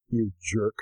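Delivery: low, soft inner voice; still small voice. Retold or imitated. The Holy Spirit quietly convicting an angry husband, still small voice. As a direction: say it low, softly and simply. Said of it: "the still small voice".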